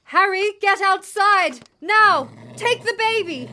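high, slow, full-force shouts; desperate shouted order. A narrator's reading. Mother sending Harry out with the baby, desperate shout. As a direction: shout each command to get them clear.

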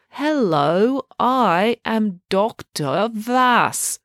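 slow condescending doctor; patronizingly slow. A narrator's reading. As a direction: drag each word out as if to a child. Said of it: "in patronizingly slow tones".

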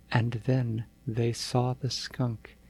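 ordinary delivery, nothing marked

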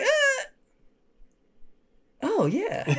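put-on high child voice, realization dawning; little girl. Girl agreeing with counselor, high imitated child voice. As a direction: imitate a small kid coming round.

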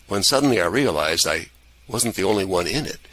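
usual speaking voice

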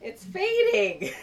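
high, slow, drawn-out whine; whiny lament. Real, not acted. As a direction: wail it like a tragedy. Repeats complaint about her shirt.

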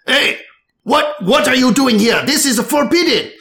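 high, alarmed bartender calling out. Acted. Bartender catches the cat behind the bar, high and alarmed.

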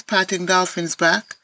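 ordinary delivery

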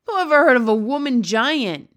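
shouted. A narrator's reading. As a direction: bellow it at the girl. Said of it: "shouted the B F G".

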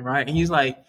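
usual speaking voice

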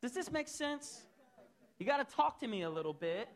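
fervent full-force sermon voice; preaching. Acted. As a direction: preach it out, high and loud. Preaching to congregation, raised voice.

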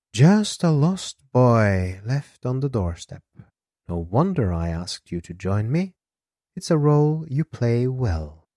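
lilting, unhurried woman's character voice; Elisa's character voice. Acted. Elisa teasing Alex about his role, in a lilting voice.

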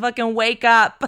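loud mock order; a hammed-up furious wake-up call; angry wife. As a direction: snap it at a sleeping husband. Wife demanding sex, voiced.

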